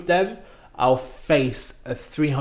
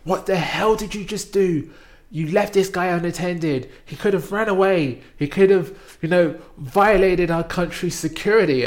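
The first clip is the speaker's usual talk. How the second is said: higher, louder angry boss yelling; retold supervisor yelling; 6 semitones higher, 6 dB louder than usual. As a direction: bawl out the underling. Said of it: "started yelling at him".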